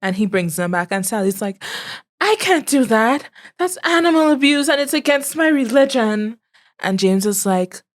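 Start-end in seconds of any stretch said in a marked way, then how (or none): 2.2-6.4 put-on prim high girl. Pious girl refuses makeup.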